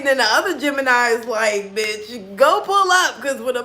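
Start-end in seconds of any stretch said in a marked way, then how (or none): 1.8-3.2 egging on. Retold or imitated. Other self eggs her on.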